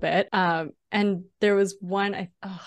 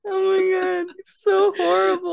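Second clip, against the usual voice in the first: laughing; 10 semitones higher, 4 dB louder than usual. Real, not acted. Reacting to the French impression.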